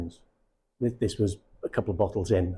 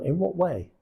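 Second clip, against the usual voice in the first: a curious, higher "in what way?"; 7 semitones higher than usual. Shown as a demonstration. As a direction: sound simply interested, not pushy. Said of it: "That's just curious".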